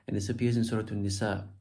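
ordinary talk, nothing marked

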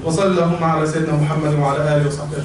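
low, fast, level closing prayer; Arabic supplication. Real, not acted. Preacher ending the sermon, low rapid closing supplication.

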